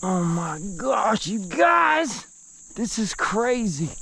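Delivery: thrilled, calling to the viewers; excited exclaim. Real, not acted. Showing off the catch.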